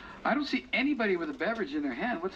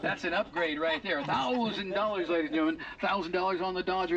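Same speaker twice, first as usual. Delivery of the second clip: ordinary talk, nothing marked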